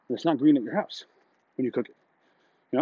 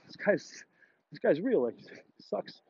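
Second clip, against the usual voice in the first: hushed aside; 4 semitones higher, 8 dB quieter, slower than usual. Retold or imitated. Whispered aside about the expert.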